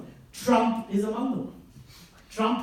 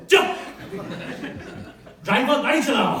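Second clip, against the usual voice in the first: loud, high bark of an irritated boss; angry Hindi shout; 4 semitones higher, 5 dB louder, much faster than usual. Retold or imitated. Boss shouts to silence Einstein and call his driver. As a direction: yell him out of the room.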